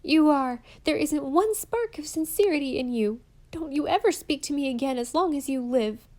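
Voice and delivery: loud and high, hurt fury; a furious girl cutting off her false friend; furious denunciation. Acted. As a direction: let the betrayal blaze out.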